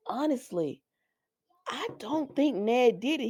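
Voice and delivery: hushed, breathy confession; whispering like Drew admitting doubt; confiding whisper. Retold or imitated. Retold confiding line of Drew.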